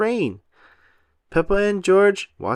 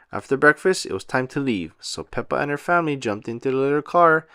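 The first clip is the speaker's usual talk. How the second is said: ordinary delivery, nothing marked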